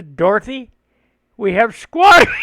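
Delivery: loud mock yell; yelling to mom. Dad yelling to wife about squatters, loud mock yell. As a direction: call through the house to the wife. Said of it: "he yells at my mom".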